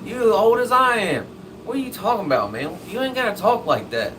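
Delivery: high, loud mock indignation; hammed-up offended objection; indignant comeback. Retold indignant comeback to greeting.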